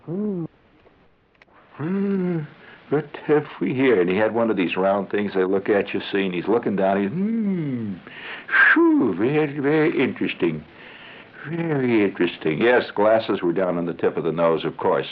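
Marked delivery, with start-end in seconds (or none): 0.1-4.0 higher humming doctor, thoughtful; musing doctor. Retold or imitated. Doctor peering at the boy, musing and higher. 7.1-12.6 higher, mock intrigued doctor; doctor hmming. Doctor peering down his glasses, mock intrigued.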